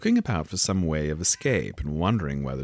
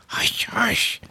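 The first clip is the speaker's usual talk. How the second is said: urgent whispered shushing; hurried hush; 3 dB louder than usual. A narrator's reading. Rabbit silences Alice, whispering hurriedly. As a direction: whisper it anxiously. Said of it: "in a low, hurried tone".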